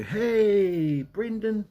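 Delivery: high, bright imitation of a stranger hailing you; friendly stranger greeting. Stranger on the street greeting a forgetful young man, high cheerful imitation. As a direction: play the overly familiar guy on the street. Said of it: "they go".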